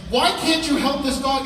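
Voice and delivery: high, loud desperate demand; desperate dog owner yelling at the vet. Retold or imitated. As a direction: shout it at the vet. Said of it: "I said".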